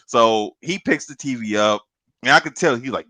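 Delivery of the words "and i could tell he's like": ordinary delivery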